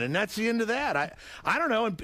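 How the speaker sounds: ordinary delivery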